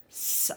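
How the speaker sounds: S said as a short "suh" sound; hissed S sound. Shown as a demonstration. Teacher showing learners the letter C, S as a hissed sound. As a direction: say S as its sound, not its letter name. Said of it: "it will be pronounced".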